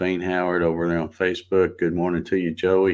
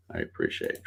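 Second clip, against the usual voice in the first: repeated much lower and softer; 12 semitones lower, 9 dB quieter, faster than usual. Real, not acted. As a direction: let it drop low, almost to yourself. Repeats thanks to viewers.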